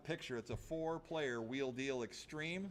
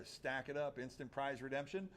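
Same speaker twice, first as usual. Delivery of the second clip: usual speaking voice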